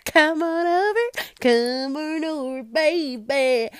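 playful fake Southern twang; mock country drawl. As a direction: drawl it like a country singer inviting you over. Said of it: "I wanna be country so bad".

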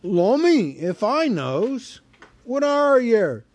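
loud, slow puzzled troll; a dim troll scratching his head. A narrator's reading. Troll puzzles over Bilbo.

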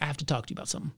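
retold in a hushed, serious voice; quiet, understated seriousness; super serious. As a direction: drop the volume for a grave confession. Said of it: "I was super serious".

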